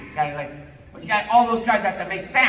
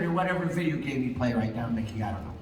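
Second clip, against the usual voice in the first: ordinary delivery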